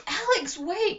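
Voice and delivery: loud, high call after her; urgent yell into the trees. A narrator's reading. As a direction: shout for her to stop. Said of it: "Connor ordered".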